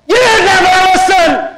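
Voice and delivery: Lucy snaps back to yelling; sudden yell. Retold or imitated. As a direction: burst out shouting at Linus. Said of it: "if I spoke to you quietly".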